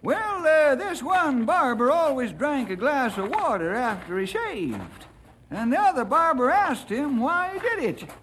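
put-on high-pitched old-timer eager to tell a joke; old-timer storyteller.

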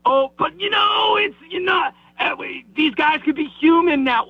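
high, whiny-pitched mock objection; mocking imitation. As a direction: put on a high voice to mock the excuse. Mocking excuses for the broadcaster.

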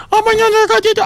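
put-on high cooing baby talk. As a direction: coo it like talking to a lover. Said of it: "me manda audios y me dice".

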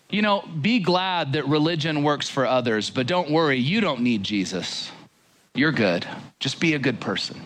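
usual speaking voice

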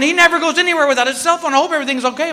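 high, fast worried wife. Retold or imitated. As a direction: fret like an anxious wife. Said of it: "she starts going".

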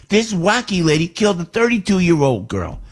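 ordinary delivery

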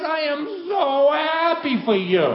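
very high, loud mock congratulations; imitated father at the peak of his rant; sarcastic dad blowup. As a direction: yell the goodwill like an accusation. Climax of dad's sarcastic tirade.

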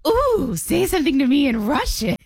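a grandma begging a stranger for Russian, delighted; flirty grandma. Retold or imitated. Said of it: "she goes".

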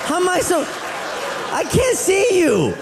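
high put-on voice of a confused mother on the phone; mother-in-law voice. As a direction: squeak it out like a confused grandma.

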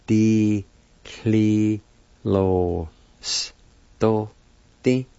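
very slow, each syllable separated by a pause. Shown as a demonstration. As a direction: draw out and separate every syllable for learners. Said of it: "is pronounced like this".

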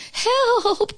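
high-pitched cry for help; alarmed storybook Toad calling out. A narrator's reading. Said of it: "cried Toad".

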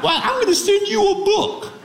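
hammed-up Southern accent, pitched very high; high Southern impression of an in-law; mother-in-law's Southern accent. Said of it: "She has a one-of-a-kind Southern accent".

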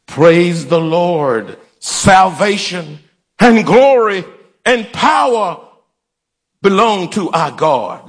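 slow, emphatic praise with long pauses; grand proclamation. Shown as a demonstration. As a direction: proclaim each phrase separately, drawing it out. Demonstrates praise line for congregation.

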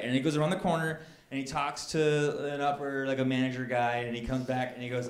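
usual speaking voice

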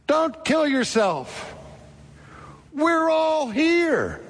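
slow, high, urgent pleading; loud plea. A narrator's reading. Paul calls to the jailer, slow and high-pitched. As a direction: hold him back with a slow high call. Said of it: "crying in a loud voice".

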